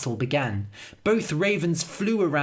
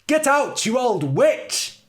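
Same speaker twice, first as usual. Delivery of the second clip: much higher, loud, slow yell; furious shout; 9 semitones higher, 6 dB louder than usual. A narrator's reading. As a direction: scream at the witch. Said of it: "she cried".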